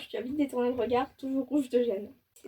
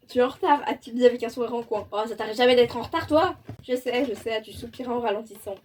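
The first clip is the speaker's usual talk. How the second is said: ordinary delivery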